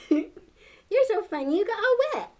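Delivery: excited. Real, not acted. Laughing, delighted praise to child.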